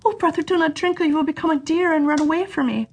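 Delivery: put-on high pleading girl's voice; sister pleading. A narrator's reading. Sister begs her brother at the third spring, high.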